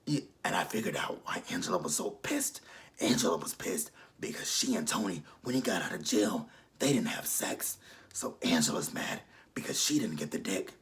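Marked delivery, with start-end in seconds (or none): 0.1-7.1 husky mock of Angela's voice; whispered Angela voice. 8.1-10.7 low whispered rasp, mocking Angela; husky mock of Angela's voice. Vlogger gossiping in Angela's low whisper.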